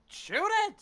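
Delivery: very high, loud yell; yelling order. Acted. Player yelling at the general to attack, yelling order. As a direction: holler the order at full force. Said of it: "I'll yell at the general".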